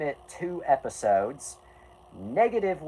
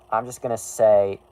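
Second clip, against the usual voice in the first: ordinary talk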